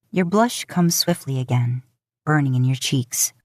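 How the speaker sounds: ordinary delivery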